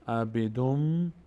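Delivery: عبیدوم ending on a held, hummed "m"; nun becomes mim. Shown as a demonstration. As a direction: slowly close عبیدوم with a long "mmm".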